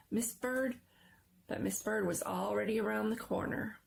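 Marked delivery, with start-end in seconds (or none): none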